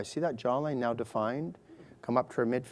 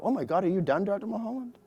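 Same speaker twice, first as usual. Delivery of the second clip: imitating relieved patient; 8 semitones higher than usual. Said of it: "they go".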